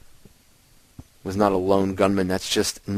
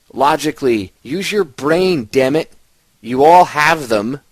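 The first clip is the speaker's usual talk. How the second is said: higher, louder and slow, hammering it home; exasperated outburst; 5 semitones higher, 6 dB louder, slower than usual. Real, not acted. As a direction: drag each word out like you're fed up with them. Exasperated plea to listeners.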